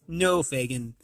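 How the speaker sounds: ordinary delivery, nothing marked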